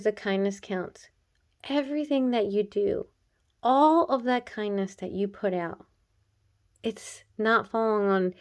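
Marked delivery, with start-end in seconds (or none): none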